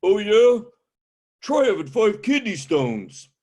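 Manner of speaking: loud, high, boastful challenge; one-up him with a loud macho brag; macho old man. Retold or imitated. Old man trying to top the comedian's complaint, loud and boastful. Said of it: "they got macho on me".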